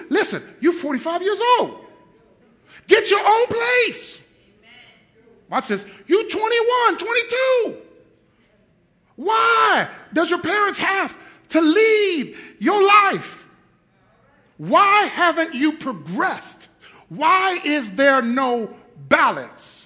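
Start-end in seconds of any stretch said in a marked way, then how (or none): 0.1-4.2 mock scolding. Preacher scolding the couch son. 6.1-19.8 high, loud, slow rebuke; preaching shout. Acted. Preacher shouting at grown kids living at home.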